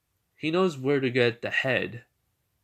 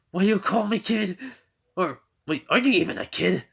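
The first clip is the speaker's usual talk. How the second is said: raised, louder incredulous retort; indignant questioning; 9 semitones higher, 6 dB louder than usual. Retold or imitated. Character objects to being called a kid, indignant. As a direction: act out someone taken aback.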